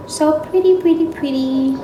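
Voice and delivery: high, admiring coo; cooing over her hair. Real, not acted. Vlogger admiring her freshly unbraided hair, high cooing. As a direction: gush over your own hair in a high voice.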